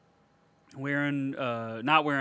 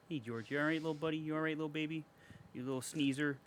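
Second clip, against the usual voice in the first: hushed coo to a little pet; 5 dB quieter than usual. Real, not acted. Talking to pet George.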